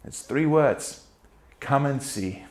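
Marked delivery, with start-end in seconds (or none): 1.6-2.4 much softer, tender and inviting; gentle invitation. Retold or imitated. Preacher voicing Jesus inviting the disciples, hushed and tender. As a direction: say it like a soft welcome.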